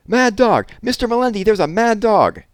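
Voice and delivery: frantic high-pitched alarm cry; panicked yell. A narrator's reading. Maid calling Mister Melendi in terror, loud shriek. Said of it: "yelled Cuffy's voice".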